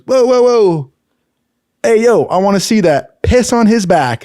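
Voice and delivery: loud, high instructor holler; excited calling out. Retold or imitated. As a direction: cut in shouting, imitating an eager instructor.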